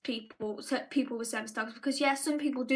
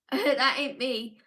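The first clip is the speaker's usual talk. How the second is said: ordinary talk, nothing marked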